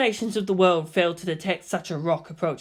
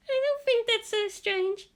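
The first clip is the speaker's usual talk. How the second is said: put-on much higher girl's voice, slow and calm; Hikari, gentle; 3 dB quieter than usual. A narrator's reading. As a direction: calmly stand up for the new boy. Hikari defends Shinji.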